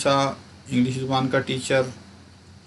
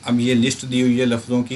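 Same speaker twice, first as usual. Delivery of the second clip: ordinary delivery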